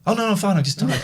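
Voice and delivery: high-pitched impression of a young boy; youngest son. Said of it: "He went".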